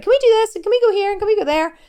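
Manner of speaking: put-on high, loud whiny kids asking over and over; pestering kids. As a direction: mimic the kids begging for outings. Kids pestering mum with requests.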